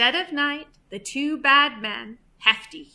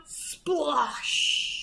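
long hissing splosh; splash sound effect; 3 semitones higher than usual. A narrator's reading. Thieves step into the duck pond, drawn-out splosh. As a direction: stretch it out like water spraying.

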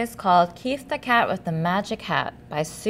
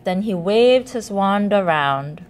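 ordinary delivery

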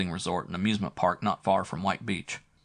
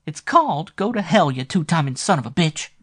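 much higher, louder woman's snap; snapping, furious; 9 semitones higher, 8 dB louder than usual. A narrator's reading. Flo cursing Matt the two-timer as she drives off, loud furious snap. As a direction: spit the insult as she leaves. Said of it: "she snapped".

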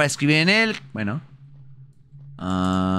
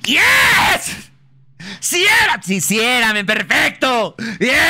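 triumphant shouting; 9 semitones higher, much slower than usual. Real, not acted. Triumphant after gamble works.

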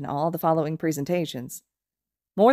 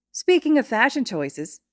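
higher, slow, teasing lead-in with "speaking" stressed; playful segue; 7 semitones higher, slower than usual. A narrator's reading. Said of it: "Ashido says".

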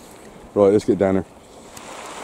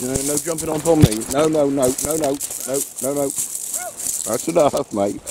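higher scolding no-no; 6 semitones higher than usual. Real, not acted. Dog jumping up on him.

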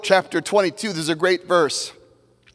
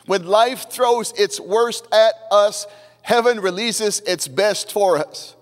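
raised, measured pulpit cadence; fervent preaching; 4 semitones higher than usual. Acted. Pastor assuring the congregation of God's promise, fervent cadence.

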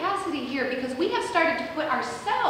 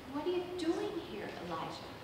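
very soft, gentle question; hushed, as a faint divine voice; God's whispery voice; 3 semitones higher, 12 dB quieter than usual. Acted. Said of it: "he tunes his ear to this whisper".

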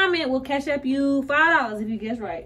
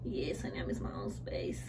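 breathy whisper, voice dropped much softer and lower; whispered longing; 12 semitones lower, 13 dB quieter than usual. Real, not acted. Sister whispering to the camera how much she misses her old space, softly. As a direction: whisper it like a confession of homesickness.

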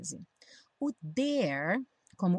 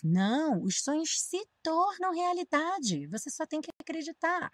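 raised, insistent conviction; eager believer; 8 semitones higher than usual. Acted. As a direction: lift the voice to insist dreams are real. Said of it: "uma pessoa que acredita diz". (uma pessoa que acredita insiste, animada e mais aguda)

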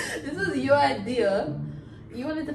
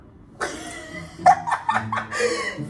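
high shrieking laugh. Real, not acted. Friend teasing about being drunk.

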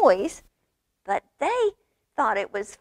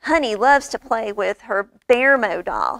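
usual speaking voice

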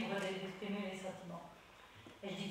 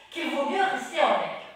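loud witch voice, a bit higher; 5 semitones higher, 13 dB louder than usual. Acted. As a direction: witch deciding to stay up. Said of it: "une voix de sorcière".

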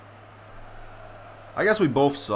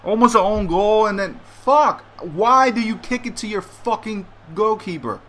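angry rant; 5 semitones higher, 4 dB louder than usual. Real, not acted. Raging at his own goal.

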